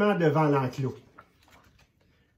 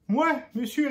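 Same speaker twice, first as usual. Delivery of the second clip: Lucie small and high, eager; a bright little girl promising; petite fille; 9 semitones higher than usual. A narrator's reading. Lucie répond, voix d'enfant. (petite voix aiguë d'enfant qui promet)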